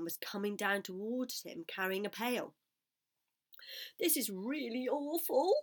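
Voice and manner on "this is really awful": despairing thought. A narrator's reading. Frightened pig's despairing thought.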